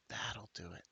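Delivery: breathy conspiratorial whisper. Acted. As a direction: whisper it like a secret tip. Informant repeats it, whispered.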